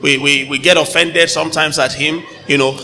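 normal talking voice

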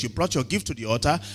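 usual speaking voice

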